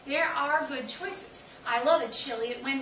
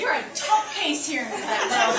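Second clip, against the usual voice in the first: laughing; 11 dB louder than usual. Real, not acted. Teasing a laughing audience member.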